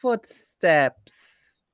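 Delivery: "footsteps" slowly joined from two parts; "footsteps" with both halves firmly sounded; blended word. Shown as a demonstration. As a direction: blend "footsteps" loud and clear. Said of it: "It sounds like".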